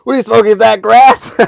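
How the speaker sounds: loud, high mock shriek; hammed-up outraged mom; angry mom. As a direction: yell it as an imitation of mom, near laughing. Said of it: "She was pissed".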